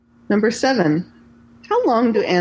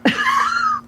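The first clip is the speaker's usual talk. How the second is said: sudden high laugh. Real, not acted. Caught by a friend's jab, high laughing burst. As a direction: shriek of a laugh, much higher.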